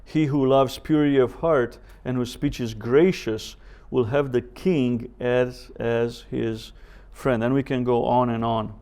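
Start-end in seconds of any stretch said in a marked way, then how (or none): none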